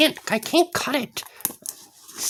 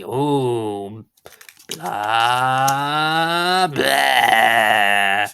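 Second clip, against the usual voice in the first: spooky drone; 7 semitones lower, much slower than usual. Pretend. Scary sound after "it's scary.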